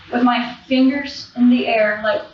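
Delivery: usual speaking voice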